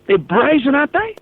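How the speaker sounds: a caller's host jumping in, shocked and high; indignant outburst. Real, not acted. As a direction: pitch it way up in outrage at them. Indignant about political ad.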